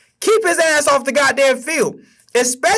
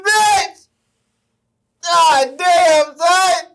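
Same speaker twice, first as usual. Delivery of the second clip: despairing fan shouting curses, higher; anguished cries; 8 semitones higher, much slower than usual. Real, not acted. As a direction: cry out in sports-fan agony. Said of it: "I'm about to cry".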